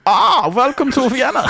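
high, hammy Viennese host; put-on high Viennese accent. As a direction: welcome listeners in a comic Vienna accent. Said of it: "Do your Vienna accent".

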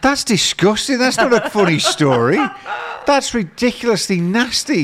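high, appalled disgust. Real, not acted. Reacts to dog pee story.

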